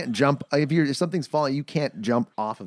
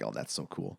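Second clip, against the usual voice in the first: quiet awed voice; 13 semitones lower, 14 dB quieter than usual. Retold or imitated. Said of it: "I'm like".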